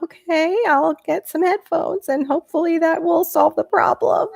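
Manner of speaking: high-pitched mock-compliant voice; high, playful self-imitation; imitated meek reply. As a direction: put on a high voice, as if giving in to a telling-off. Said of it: "And I'm like".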